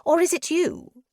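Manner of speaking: high, loud sudden accusation; mad prisoner, prying. A narrator's reading. Prisoner snaps his gaze to her, madly prying. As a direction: pounce on it in a high voice.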